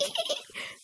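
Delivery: tiny, very high squeal; running-away kid giggle. Retold or imitated. Showing how cousin ran away, high kid giggle. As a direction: imitate a kid's soft, high scampering giggle. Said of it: "how kids run away".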